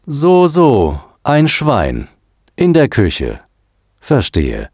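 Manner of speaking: drawn-out, pondering, dropping at the end; slow. A narrator's reading. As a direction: take it in piece by piece, with pauses. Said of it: "sagte er langsam". (langsam und bedächtig, mit Pausen)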